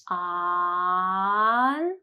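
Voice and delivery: long drawn-out ah in "On"; held ah. Shown as a demonstration. Teacher drawing out the ah in "On" for learners. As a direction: stretch the ah of "On" out long. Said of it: "has the ah sound right at the beginning".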